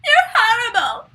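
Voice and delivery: high, loud mock yell at grandma; imitating a yell. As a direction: scream it like a bratty kid in a movie. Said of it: "she's yelling at her saying".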